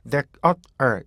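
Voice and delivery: "that, uh, er" as three separate beats. Shown as a demonstration. Teacher splitting the word into three beats. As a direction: split "there" into "that, uh, er". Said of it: "이 there, 이 발음은요".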